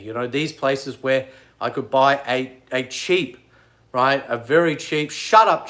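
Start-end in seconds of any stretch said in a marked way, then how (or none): 5.1-5.7 snap at heckler. Real, not acted. Snapping at commenter John.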